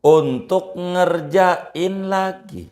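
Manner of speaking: slow high sermon delivery; emphatic preaching. Acted. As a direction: drive the rule home to the congregation, slowly. Preacher states the rule emphatically.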